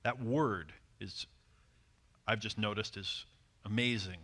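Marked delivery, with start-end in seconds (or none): none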